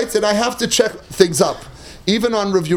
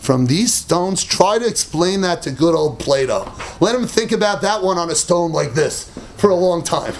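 ordinary talk, nothing marked

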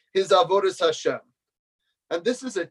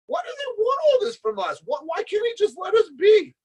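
very high-pitched exasperated protest; angry outburst. Retold or imitated. Student demanding why Hashem won't let us be, high exasperated outburst. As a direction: imitate a student crying out in frustration. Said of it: "he had an outburst".

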